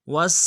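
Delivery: وس with a sharp hissing sin, loud; whistled sin. Shown as a demonstration. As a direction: hiss the sin of وس clearly. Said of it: "سین کی سیٹی". (وس میں سین کی سیٹی صاف)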